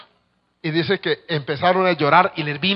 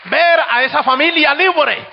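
much higher and louder, slow triumphant exclaim; jubilant cry; 10 semitones higher, 8 dB louder, slower than usual. Retold or imitated. Sister retells seeing the family freed, jubilant high cry. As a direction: ring out the joy of a freed woman.

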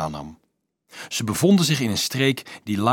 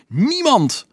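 very high, loud cry; yell; 14 semitones higher, 5 dB louder than usual. A narrator's reading. Dolf answers who gave him authority, yelling. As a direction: shriek the one word in anger. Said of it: "gilde Dolf".

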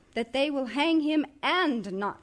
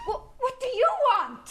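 startled; 11 semitones higher than usual. Acted. Startled by knock.

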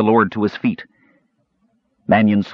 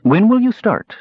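much higher lady's voice; woman's voice; 14 semitones higher, 3 dB louder than usual. A narrator's reading. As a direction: voice the noblewoman high and light. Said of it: "Lady Massac asked".

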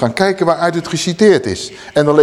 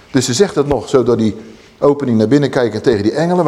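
normal talking voice

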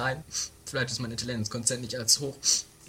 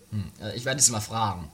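ordinary talk

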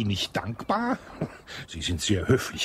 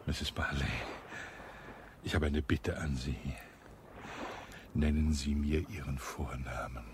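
hushed intimate request; 6 semitones lower, 7 dB quieter than usual. Acted. Colonel asks widow's first name.

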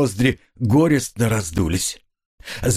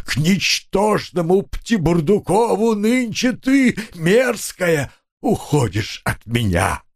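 high, slow declaimed verse lament; drawn-out whining tone; 5 semitones higher than usual. A narrator's reading. Grieving husband rants in iambic pentameter, whining theatrically. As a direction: intone it like tragic verse, stretching the vowels. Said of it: "в том же тягучем тоне".

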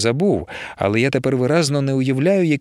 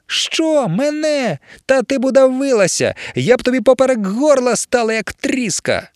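outraged shriek; 11 semitones higher than usual. Acted. Emilia outraged at the snake.

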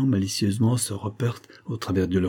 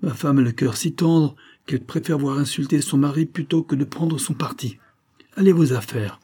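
bitter sneer; 7 semitones higher than usual. A narrator's reading. Husband sneering about his tender-hearted wife, bitter. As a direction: spit out the sarcasm.

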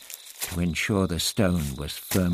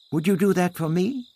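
a touch eager, pitched up; eager exclamation; 11 semitones higher, 5 dB louder than usual. A narrator's reading. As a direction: ask it with sudden hope. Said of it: "Ixta exclaimed".

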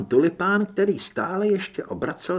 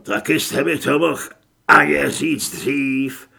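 annoyed complaint; 7 dB louder than usual. A narrator's reading. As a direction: complain loudly, stretching the last words. Thief complains after a blunder. (hlasitá otrávená stížnost)

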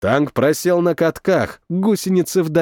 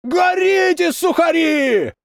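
gloating shout; 14 semitones higher, slower than usual. A narrator's reading. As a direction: taunt the burning enemy at full voice. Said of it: "кричали со стороны наших".

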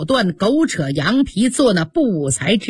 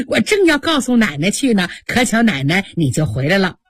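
ordinary delivery, nothing marked

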